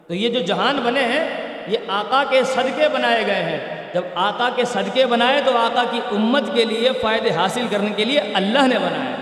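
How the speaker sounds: normal talking voice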